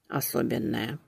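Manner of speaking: soft embarrassed mumble; grumbled. Acted. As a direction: grumble it back, blushing. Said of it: "буркнула я, отчаянно краснея".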